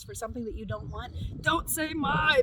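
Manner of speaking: usual speaking voice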